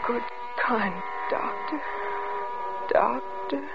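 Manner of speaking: low, dazed murmuring hope; wistful pleading. Acted. Wife clinging to hope in the absent doctor, pleading. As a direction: plead faintly, trailing into a lingering appeal.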